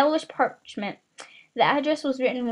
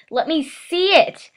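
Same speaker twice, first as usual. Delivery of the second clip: high, loud slow demand; 6 semitones higher, 6 dB louder, slower than usual. A narrator's reading. Son demanding to see the letter, loud. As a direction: whine it out as a demand. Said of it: "demanded Dudley".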